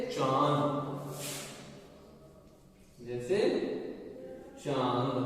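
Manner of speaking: चांद with the vowel voiced through nose and mouth; nasal vowel demo. Shown as a demonstration. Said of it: "नाक और मुख दोनों से निकले". (चांद में अनुनासिक ध्वनि)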